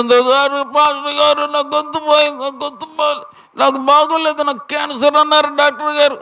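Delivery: high, thin squeak of a woman who has lost her voice; squeaky voiceless woman. Retold or imitated. Woman with throat cancer begging the pastor, high strained squeak. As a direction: imitate a sick woman straining to speak. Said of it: "కీచు స్వరంతో అరుస్తూ ఉందట".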